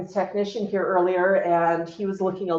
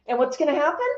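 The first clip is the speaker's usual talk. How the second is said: ordinary delivery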